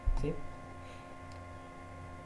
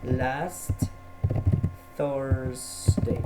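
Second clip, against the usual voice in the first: drawn-out answer, word by word; slow dictation; 2 semitones lower, much slower than usual. Shown as a demonstration. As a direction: dictate the model answer slowly. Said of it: "puedo colocar".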